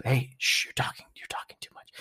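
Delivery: breathy hushing whisper, fast; mock whisper. Host hushing listeners to close the rant, whispered. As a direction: shush the listener playfully.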